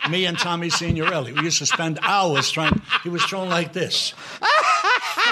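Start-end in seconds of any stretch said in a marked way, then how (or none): 4.4-5.3 high, squeaky cry of disbelief, laughing; a laughing, pitched-up what; mock disbelief. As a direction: yelp it like you can't believe your eyes. Mocking how De Niro threw.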